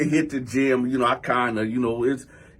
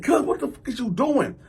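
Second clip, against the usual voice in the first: high, exasperated challenge; retold indignation; 7 semitones higher than usual. Man blocking cousin's punches, high indignant question. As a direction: act out appealing to a swinging cousin, pitched up.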